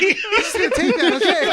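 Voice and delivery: mock smug acceptance. Friend mocks how Brian took the joke, laughing and high. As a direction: take the compliment smugly, half laughing. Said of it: "He was like".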